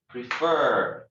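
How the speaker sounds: prefer with the weight on FER; stress on second syllable. Shown as a demonstration. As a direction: emphasise the second half of prefer. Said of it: "intonation is in the second syllable".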